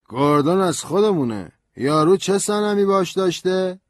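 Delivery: put-on high, loud drunk voice; hostile drunk. A narrator's reading. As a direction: eye the stranger with distrust. Suspicious drunk lawyer.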